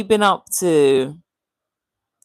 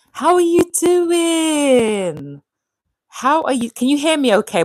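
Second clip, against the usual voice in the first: loud greeting; 8 semitones higher, 3 dB louder than usual. Real, not acted. Greeting her live viewers over the fan, loudly. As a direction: call warmly over the fan noise.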